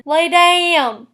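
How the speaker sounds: drawn-out Southern vowels; Southern accent. Shown as a demonstration. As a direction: stretch it slowly in a Southern drawl. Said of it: "pronouncing a slight I sound, as in".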